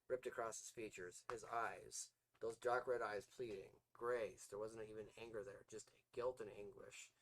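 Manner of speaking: ordinary delivery, nothing marked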